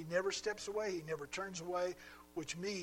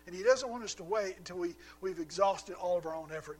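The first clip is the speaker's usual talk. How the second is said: loud, rising preacher's call, pushing each phrase higher; fervent preaching; 3 semitones higher, 9 dB louder than usual. Acted. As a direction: build the sermon to full force. Preaching builds to full force.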